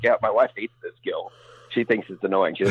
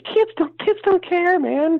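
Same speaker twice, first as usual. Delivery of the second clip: much higher, louder outburst; emphatic; 4 dB louder, slower than usual. Real, not acted. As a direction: burst out in exasperation. Emphatic rant.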